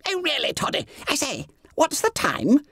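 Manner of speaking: high, hushed schoolboy whisper; squeaky fat boy whispering; whispering in class. A narrator's reading. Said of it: "actually whispering in class".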